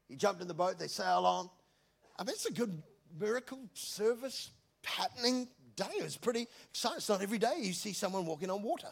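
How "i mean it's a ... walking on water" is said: usual speaking voice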